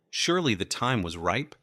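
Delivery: ordinary delivery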